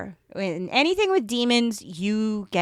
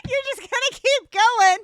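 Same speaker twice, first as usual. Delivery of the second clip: high and louder, amused; amused exclaim; 6 dB louder than usual. Real, not acted. Laughing at her co-host's running joke, high and loud. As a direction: tease her through laughter.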